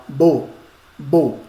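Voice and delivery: "Bo" isolated as the stressed syllable, repeated after a pause; single out "bo" as the stressed beat; firm, separate "bo", said twice; stressed syllable demo. Shown as a demonstration. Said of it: "olha a tonicidade aonde está".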